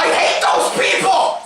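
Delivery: high, shouted rant; loud comic indignation; exasperated outburst. Real, not acted. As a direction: shout your loathing. Friend's outburst about peppy girls.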